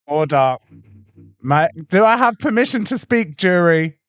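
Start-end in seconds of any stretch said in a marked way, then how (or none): 1.4-4.0 loud. Real, not acted. Demanding to be heard in argument.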